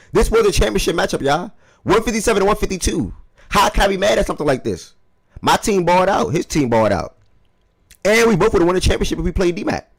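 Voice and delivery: high and loud, buzzing with excitement; hyped up. Real, not acted. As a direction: sell the big matchup. Hyped about the championship matchup.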